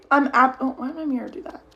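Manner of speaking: usual speaking voice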